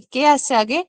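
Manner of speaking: ordinary talk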